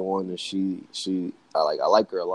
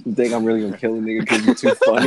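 loud, higher, laughing; 5 semitones higher, 5 dB louder than usual. Real, not acted. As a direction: laugh it out in disbelief. Laughing at the absurd accusation.

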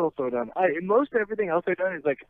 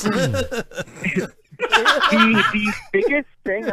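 laughing; 5 semitones higher, 6 dB louder, much slower than usual. Real, not acted. Laughs while answering question.

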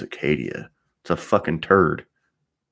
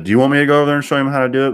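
rapid, higher, louder retold jab; a retold heated offer, fast and raised; fast exasperated challenge; 8 semitones higher, 5 dB louder, much faster than usual. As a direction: rattle it off in disbelief. Retelling his challenge to service advisor.

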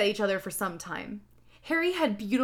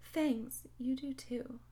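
soft, mildly sad voice; small, mock-sad reply; said sadly; 3 semitones higher, 11 dB quieter, slower than usual. A narrator's reading. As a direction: say it quietly, a bit downcast. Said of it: "I said sadly too".

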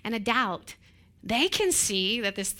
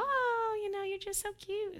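much higher, softer cooing; doting on kids; 9 semitones higher, 6 dB quieter than usual. Retold or imitated. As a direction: coo at your child. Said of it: "You tell your kids".

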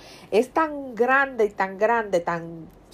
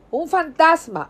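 high, louder cry of a ghost sighting; frightened shout; 8 semitones higher, 4 dB louder, slower than usual. Acted. Disciples in the boat crying out in fear. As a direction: yell "¡Un fantasma!" in fear, pitched up.

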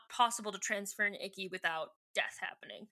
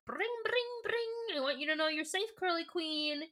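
high sing-song phone ring, then higher voice; mock phone call; 7 semitones higher than usual. Retelling Cassie's message to a dead woman, playful high voice. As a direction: trill a high ringing phone and answer it.

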